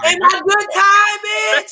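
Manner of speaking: high, loud, drawn-out mock outrage; aggrieved dad. Comic echoes the imagined dad over his tie, high mock outrage. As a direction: stretch it out high and loud, hammed-up.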